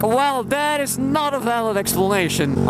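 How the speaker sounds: high stiff official voice; mock the cop's pompous tone; imitating a cop. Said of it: "And he's like".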